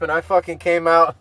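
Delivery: ordinary delivery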